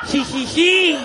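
high, loud mocking "jijiji" giggle; a smug little texting giggle, hammed up. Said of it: "así teclean las mujeres". (risita burlona "jijiji")

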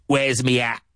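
Cockney, h dropped in hat, me for my; a London street voice; Cockney accent. Shown as a demonstration. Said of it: "in Cockney English".

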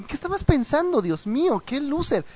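agudo y exagerado, burla de Rarity; diva escandalizada. Retold or imitated. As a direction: finge horror de diva. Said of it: "estoy exagerando un poco". (imitando a Rarity horrorizada ante Twilight)

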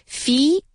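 "Feet" with the ee rising as if two syllables. Shown as a demonstration. Teacher showing a doubled i, "Feet" in two rising beats. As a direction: split the ee of "Feet" into fee-eet. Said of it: "como si la I tuviese dos sílabas".

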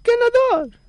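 grito de ganador, mucho más agudo; anúnciale el premio como presentador de concurso; mock winner announcement. (presentador exclamando ganador, agudo)

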